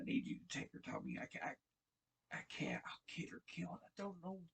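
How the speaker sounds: breathless whispered plea, voice nearly gone; broken whisper. Retold or imitated. Joe begging Tommy to take Ellie, a broken whisper. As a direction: whisper it like you're falling apart. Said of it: "he's breaking down and crying".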